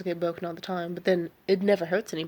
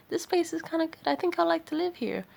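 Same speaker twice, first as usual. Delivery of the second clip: higher-pitched, pleased newcomer voice; naive newcomer; 10 semitones higher than usual. Acted. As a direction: play an approving newcomer, pitched up. Voicing a newcomer liking the place.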